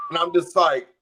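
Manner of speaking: normal talking voice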